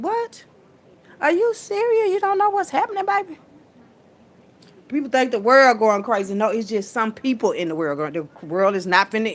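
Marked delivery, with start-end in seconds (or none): 0.0-3.4 high-pitched incredulous questioning; exaggerated, high-voiced astonishment; mock disbelief. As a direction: question them as if you can't believe it. Mock disbelief at clueless people.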